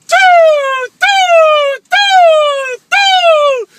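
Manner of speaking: high loud doo sounds like rockets; vocal firework effects; firework noises. Retold or imitated. Imitating fireworks going off.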